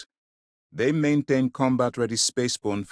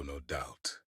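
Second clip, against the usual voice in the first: normal talking voice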